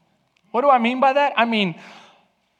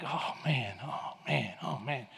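much softer, low exasperated mutter; muttered impatience; 6 semitones lower, 14 dB quieter, slower than usual. Retold or imitated. Husband waiting at the car door, muttering. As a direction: grumble under your breath.

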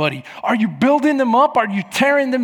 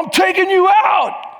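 raised, higher-pitched taunt; retold yell; 9 semitones higher, 4 dB louder than usual. Dad taunting his son on the slopes, retold yell. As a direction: holler it as a playful threat.

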